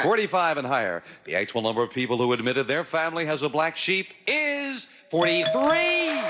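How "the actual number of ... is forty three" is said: big, rising reveal announcement; game show host. Acted. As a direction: build to the reveal, then declare it.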